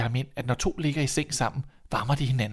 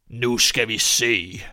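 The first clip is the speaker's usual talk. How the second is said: slow, loud challenge; menacing old man; 5 dB louder, much slower than usual. Acted. Old man taking up the boy's boast, menacing. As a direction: stretch it out ominously and loud.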